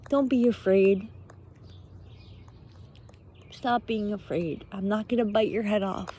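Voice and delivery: ordinary delivery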